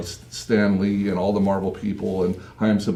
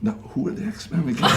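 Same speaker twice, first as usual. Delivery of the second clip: hushed, higher-pitched aside; acted whisper; 7 semitones higher, 5 dB quieter than usual. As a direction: imitate a furtive whisper to a partner. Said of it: "And I'm whispering to Julia".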